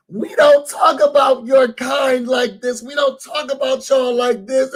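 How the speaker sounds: high, indignant mock voice; imitating her outraged denial; mocking the woman. Mocking the woman as she denies racism, high and indignant.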